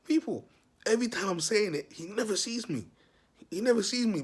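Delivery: can't believe it, raise the pitch; excited disbelief. Real, not acted. Insisting to viewers he was never seen, high and loud.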